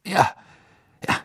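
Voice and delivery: high, quick, irritated minister; testy official rushing to move on; impatient. A narrator's reading. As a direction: brush it off hurriedly. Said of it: "sagte Fudge ungeduldig".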